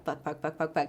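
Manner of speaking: soft, low-voiced string of curses; quietly cursing as the lady walks over; panicked muttering. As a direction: mutter it under your breath in a panic.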